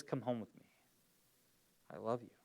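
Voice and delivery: a husband calling his wife home; tender invitation. Retold or imitated. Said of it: "And he says".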